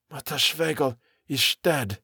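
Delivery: tremulous, haunted statement; quavering. A narrator's reading. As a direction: falter through it in dread. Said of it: "Kurtz's voice quavered".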